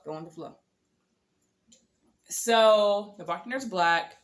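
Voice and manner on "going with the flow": Going with the flow repeated much softer, under the breath; muttered to herself. Retold or imitated. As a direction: mumble it as a private reminder.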